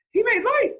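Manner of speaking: high, loud cry of amazement; excited shout. A narrator's reading. Onlooker shouting to the crowd, loud and high. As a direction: yell it out in wonder. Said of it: "someone shouted".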